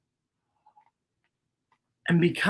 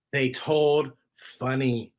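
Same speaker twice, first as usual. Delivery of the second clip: plain reading voice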